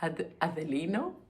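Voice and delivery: Adelino retried with a tentative Portuguese sound; an unsure try at saying Adelino the Portuguese way; Portuguese accent. Shown as a demonstration. Correcting herself to a Portuguese accent on Adelino. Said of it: "En, euh, portugais".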